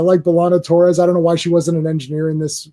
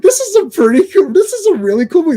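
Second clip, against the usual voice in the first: high-pitched gush, a bit faster; pitched way up in excited delight; excited movie fan; 10 semitones higher than usual. Retold or imitated. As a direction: gush like a kid loving the movie. Excited reaction while watching.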